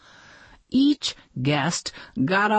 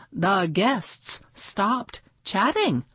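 ordinary delivery

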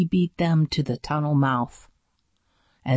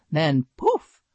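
ordinary delivery, nothing marked

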